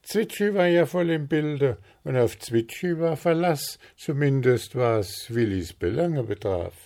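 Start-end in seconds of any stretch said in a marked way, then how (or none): none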